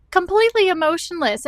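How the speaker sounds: shoots up high and loud in disbelief; practically squeals the complaint; exasperated outburst. Real, not acted. Vlogger venting to viewers about a cold phlebotomist, exasperated outburst.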